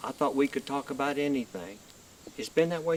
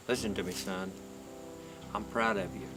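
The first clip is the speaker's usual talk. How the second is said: tender; 7 dB quieter than usual. Acted. Grandfather comforting suicidal grandson.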